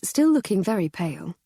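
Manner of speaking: usual speaking voice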